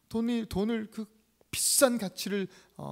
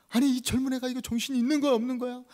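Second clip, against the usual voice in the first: fast, raised-pitch telling-off; mild mock yelling; 6 semitones higher, 6 dB louder, faster than usual. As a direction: scold her quickly, only mildly heated. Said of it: "하면서 막 소리를 칩니다". (빠르게 다그치는 제자 흉내)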